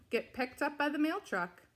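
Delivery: usual speaking voice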